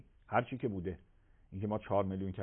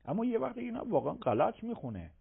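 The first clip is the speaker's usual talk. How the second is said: usual speaking voice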